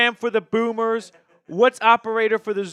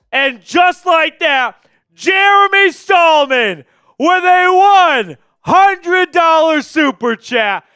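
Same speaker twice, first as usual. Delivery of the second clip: slow, drawn-out shout, high and loud; hype shout; 7 semitones higher, 10 dB louder, slower than usual. Real, not acted. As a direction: announce it like a prizefight. Announcing a big super chat.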